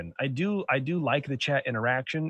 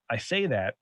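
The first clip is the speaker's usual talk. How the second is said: usual speaking voice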